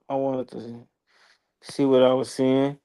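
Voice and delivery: ordinary talk, nothing marked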